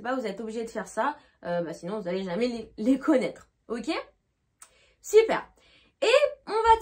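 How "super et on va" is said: high, loud cheerful super; excited. Real, not acted. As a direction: cheer it out to the class. Teacher cheering after a question.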